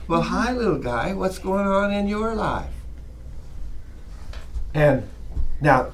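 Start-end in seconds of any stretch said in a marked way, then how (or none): 0.1-2.7 put-on soft, lilting child-directed speech; sing-songy. Shown as a demonstration. Teacher chatting to a two-year-old boy, sing-songy. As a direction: talk to a little one in a gentle singsong. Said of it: "I go into this little sing-songy".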